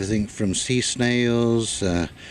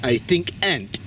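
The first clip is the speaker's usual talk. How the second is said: light imitation of a French accent, pitched up; 6 semitones higher than usual. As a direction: mimic a French friend's accent, a little higher. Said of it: "in her wonderful French accent".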